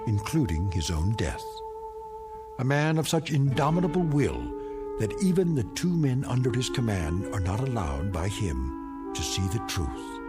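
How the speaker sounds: ordinary delivery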